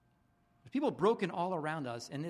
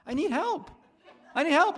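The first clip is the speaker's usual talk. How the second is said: laughing plea; 11 semitones higher, 8 dB louder than usual. Pretend. Voicing hurting person, laughing.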